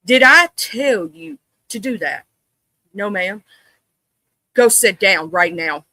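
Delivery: stern, deliberate, controlled scolding; scary mama voice. Shown as a demonstration. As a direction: lay down the law without screaming. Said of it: "I get this tone in my voice".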